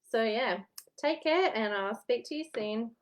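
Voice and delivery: ordinary delivery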